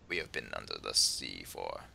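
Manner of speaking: hushed, low European Russian-ish accent. Shown as a demonstration. Player showing that weird under-the-sea voice, low Russian-ish accent. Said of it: "weird European Russian-ish voice".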